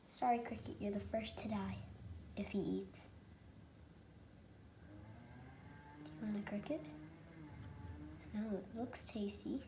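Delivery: soft unvoiced whisper to a cricket. Real, not acted. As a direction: whisper it, almost no voice.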